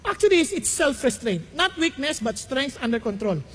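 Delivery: ordinary delivery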